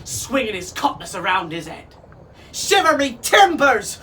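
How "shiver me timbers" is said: squawking parrot, high and loud; Neptune. A narrator's reading. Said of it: "squawked Neptune".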